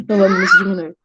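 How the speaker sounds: real laugh spilling into the words. Real, not acted. Teacher taking back a jab at herself, laughing. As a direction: laugh it off while talking.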